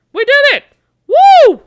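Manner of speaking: very high, very loud victory cheer; real elation, shrieked; triumphant whoop. Real, not acted. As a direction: scream with joy at the win. Winning the dice gunfight.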